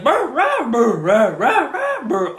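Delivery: high, loud yapping imitation; dogs barking chorus. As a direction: yap like a pack of dogs. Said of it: "And it's like".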